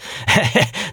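high-pitched villain's chuckle; gloating chuckle. Acted. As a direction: chuckle over the nasty trick. Villain laughs while gloating.

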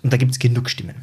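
usual speaking voice

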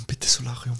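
breathy whispered bitte Solarium; whispered plea. Acted. Repeated plea, one whispered.

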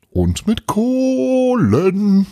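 very high, very slow crowing goblin voice. A narrator's reading. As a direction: crow it out like a cheeky Christmas goblin. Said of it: "krähte Tapani".

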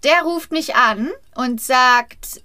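normal talking voice

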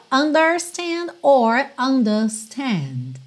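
first UNDERSTAND with curled R, then "undastand"; both R versions. Shown as a demonstration. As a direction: contrast UNDERSTAND with and without the R. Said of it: "eu enrolei a língua aqui no R".

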